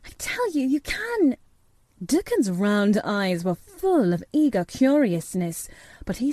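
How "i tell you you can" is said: high, insistent private encouragement; urging to herself. A narrator's reading. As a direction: insist on it under your breath. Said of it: "said Mary to herself".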